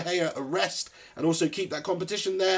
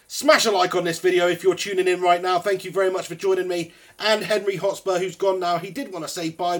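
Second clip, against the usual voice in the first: ordinary talk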